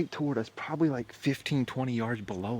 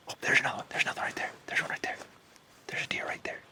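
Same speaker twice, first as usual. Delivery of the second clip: whispering; 12 dB quieter than usual. Real, not acted. Whispering near deer before second shot.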